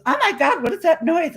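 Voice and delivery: high, startled friend's voice; alarmed friend. Retold or imitated. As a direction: jump up in pitch, alarmed by the noise. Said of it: "she goes".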